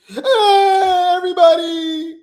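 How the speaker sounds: loud, high, tearful fake greeting; hammed-up sobbing hello to the camera; mock crying vlogger. Mocking a vlogger who cries on camera. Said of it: "It's giving acting".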